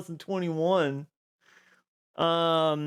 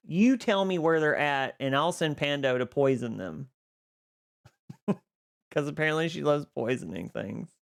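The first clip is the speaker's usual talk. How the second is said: usual speaking voice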